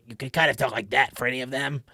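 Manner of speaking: croaky throat noise carried into words; kobold or goblin noise-voice. Shown as a demonstration. Game master demos goblin talk, creaky throat voice. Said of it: "if you ever can do that noise".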